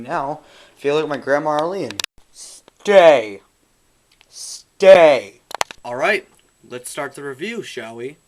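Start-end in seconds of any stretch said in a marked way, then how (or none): 2.3-5.4 loud, firm command; stern command. Real, not acted. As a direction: order it to stay put. Commanding object to stay.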